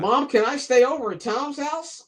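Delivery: put-on high childish phone voice; little kid voice. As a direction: sound like a timid kid asking mom. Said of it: "That kind of voice".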